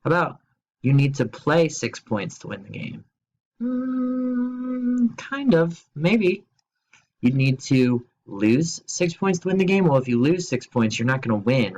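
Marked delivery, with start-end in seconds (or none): 3.6-6.4 higher, drawn-out unsure hum; hesitant teacher weighing the option; doubtful hedge. Acted. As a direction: sound unconvinced but willing.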